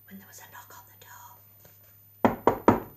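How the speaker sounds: suspenseful whisper. A narrator's reading. Narrator reaching the knock at the door, suspenseful whisper. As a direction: breathe it, barely voiced, building suspense.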